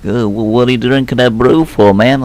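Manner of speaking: loud hammed-up plea, Abe urging friends to drop the brew; pleading Abe. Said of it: "Abe looks like he's pleading with Alf and Toby".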